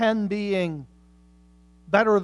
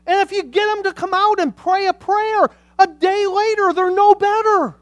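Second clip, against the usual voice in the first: high, loud, near tears on the dying; impassioned preaching; 11 semitones higher, 9 dB louder than usual. Acted. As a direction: plead it from the pulpit, voice cracking. Preaching at full force.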